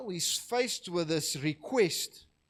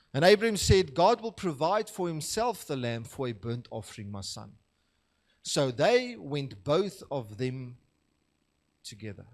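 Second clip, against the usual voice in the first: scripture reading; 4 semitones lower than usual. Acted. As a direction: read the verses with weight, pausing. Reads the scripture passage aloud.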